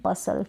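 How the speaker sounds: बसल said with no T; silent T. Shown as a demonstration. Teacher giving the correct बसल with silent T. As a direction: say बसल dropping the T. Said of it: "इसको बस्टल नहीं".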